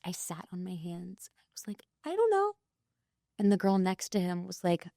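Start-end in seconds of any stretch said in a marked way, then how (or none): 0.0-1.8 unvoiced, hushed confession; sheepish whisper; embarrassed whisper. Embarrassed recollection. 2.0-2.5 pitched way up, flustered; flustered high "I don't know"; sheepish high reply. Retold or imitated. Embarrassed reply.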